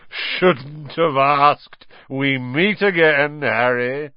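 loud, high, drawn-out joviality; grand, hearty greeting; jovial thunder. A narrator's reading. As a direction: boom it slowly and heartily. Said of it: "thundered jovially".

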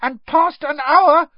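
loud, slow, high elf squeak; drawn-out high-pitched elf; Dobby. A narrator's reading. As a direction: squeak it like a dire warning. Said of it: "squeaked Dobby".

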